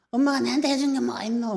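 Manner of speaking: loud, high-pitched defiant retort; talking back to mother. Retold or imitated. Grown child to parent about what they ever did, defiant. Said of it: "대들고".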